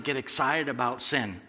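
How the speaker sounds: ordinary talk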